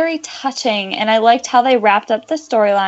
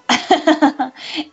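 teary laugh; 5 semitones higher than usual. Real, not acted. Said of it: "[name]'s about to cry again".

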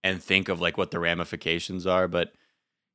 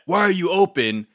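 outraged question; 10 semitones higher, 4 dB louder than usual. Retold or imitated. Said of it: "people's outrage just sort of stops at".

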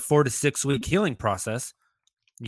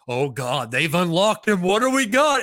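building higher and louder in hyped-up disbelief; mock amazement; 6 semitones higher, 4 dB louder than usual. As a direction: act out an overexcited fan's discovery. Acts out amazed reaction watching game.

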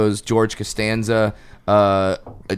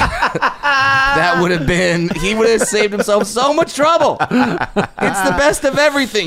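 laughing while talking; high, laughing delivery; 8 semitones higher than usual. Real, not acted. Laughing through his own joke.

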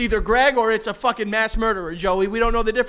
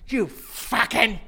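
very slow, strained mock fury; acted seething at a partner; controlled rage; 3 semitones higher, much slower than usual. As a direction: stretch each word, holding back anger. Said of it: "controlled rage".

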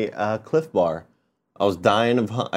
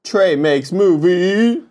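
slow, higher announcer title call; announcer read; 6 semitones higher, much slower than usual. Acted. Host announcing the segment, slow and raised. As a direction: draw out the title like a show announcer.

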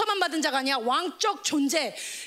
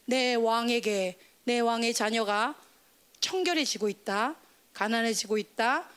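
low, measured pronouncement; deep, even voice of God; God's royal decree; 6 semitones lower than usual. Acted. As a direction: lower the voice and decree solemnly like a king. Voicing God's decree over child.

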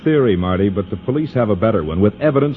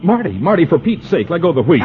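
alarmed protest; 8 semitones higher than usual. Acted. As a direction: yell at her to let go of the wheel. Wife grabs wheel while driving.

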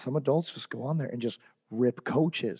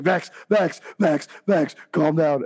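louder, a bit higher pleading; urgent restraint; 4 semitones higher, 5 dB louder, slower than usual. Retold or imitated. Assistant coach restraining Vex, urgent calm down. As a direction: hold him back with words. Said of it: "He's like".

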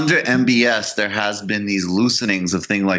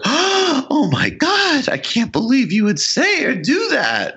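much higher, slow, scandalised squeal; mock pearl-clutching; 13 semitones higher, slower than usual. Mocking liberals shocked at America's critics, hammed-up outrage. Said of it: "put away the fucking smelling salts".

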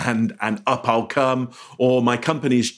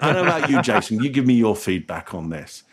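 ordinary delivery

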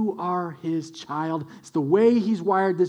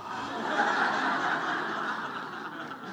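imitated toddler giggle; 10 dB quieter than usual. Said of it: "He goes".

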